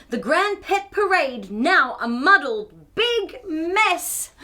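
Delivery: plain reading voice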